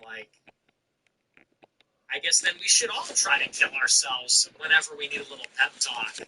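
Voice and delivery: usual speaking voice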